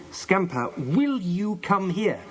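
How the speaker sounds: slow, high exasperated command; enraged. A narrator's reading. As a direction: call the dog in drawn-out, high exasperation. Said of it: "her face red with rage".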